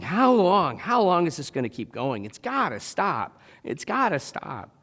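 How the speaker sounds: at wits' end. Acted. Thinking through endless troubles, at wits' end. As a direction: plead for it to end, drawing out the words. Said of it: "kind of at your wits' end".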